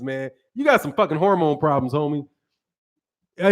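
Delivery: normal talking voice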